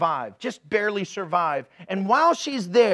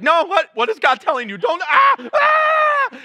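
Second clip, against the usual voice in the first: high, loud, rising into a frantic yell; a caught kid spluttering in mock panic, louder and higher; panicked teen; 10 semitones higher, 8 dB louder than usual. Teen panicking at mom's insight.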